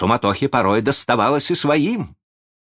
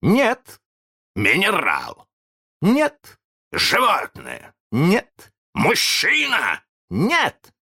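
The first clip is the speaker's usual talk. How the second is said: ответы; 11 semitones higher, much slower than usual. A narrator's reading. Peter answers in guessing game.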